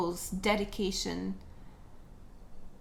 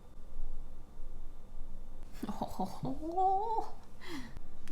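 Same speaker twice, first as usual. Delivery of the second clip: high airy laugh; 8 semitones higher, 3 dB quieter than usual. Real, not acted. Laughs at her makeup.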